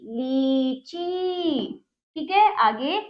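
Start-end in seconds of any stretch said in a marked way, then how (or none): 0.0-1.8 लीची very slow, both ई held long; drawn-out vowels. Shown as a demonstration. Teacher models long vowels for children, drawn out. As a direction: stretch each syllable of लीची. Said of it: "खींच रही हूँ".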